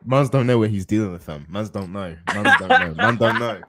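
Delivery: put-on London roadman voice, playful; mock roadman accent. Teasing his friends as a London roadman, mock accent. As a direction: lean into the London slang, half laughing. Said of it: "We ain't doing the U K voices tonight".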